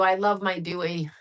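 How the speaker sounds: ordinary delivery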